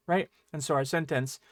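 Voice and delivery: ordinary talk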